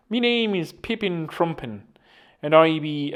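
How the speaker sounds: put-on Irish accent. Podcaster playing an invented man introducing himself, mock Irish accent. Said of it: "an Irish accent as well".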